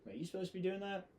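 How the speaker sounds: quick needling officer voice, lightly put on; a hint of a prickly senior officer; officer's scolding. Officer scolding a Marine, retold.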